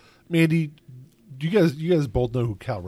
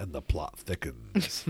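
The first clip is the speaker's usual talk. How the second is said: low, slow mock dramatic narrator; mock ominous; 6 semitones lower, 7 dB quieter, slower than usual. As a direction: drop the voice like a thriller trailer. Mock dramatic remark on conspiracy.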